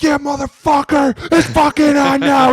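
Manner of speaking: hype shout. Retold or imitated. Kicker celebrating a made field goal, loud high shout. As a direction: scream it pumped up after the kick. Kicker after making a kick.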